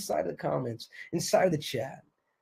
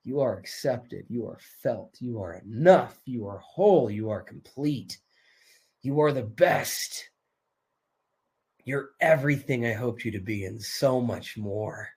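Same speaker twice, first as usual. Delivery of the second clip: low, mostly softened reassurance; tender affirmation; 7 semitones lower, 4 dB quieter than usual. Real, not acted. Coach telling listeners they are enough, tender.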